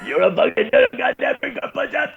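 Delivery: high, strained mock scream; drill instructor tirade. Enraged instructor tearing into joking corpsmen, mock screamed tirade. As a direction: imitate a DI losing it. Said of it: "And he goes".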